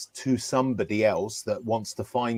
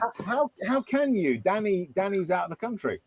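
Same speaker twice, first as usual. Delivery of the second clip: much higher, hammed-up dismay; mock lament; 8 semitones higher, 3 dB quieter than usual. Teasing a friend about Danny in mock lament. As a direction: play it as mock tragedy.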